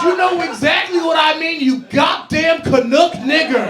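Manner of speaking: loud, slow, hammed-up insult; mock defiant shout. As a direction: spit it out slowly and loud. Insult yelled at coworker.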